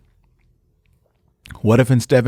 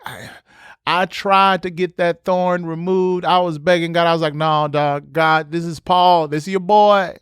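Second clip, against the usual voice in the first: higher, pleading buddy tone; casual pleading apostle, pitched up; Paul begging God; 6 semitones higher than usual. Retold or imitated. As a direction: beg God like an old friend. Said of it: "He says".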